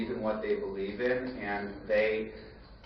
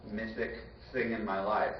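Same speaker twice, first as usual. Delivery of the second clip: normal talking voice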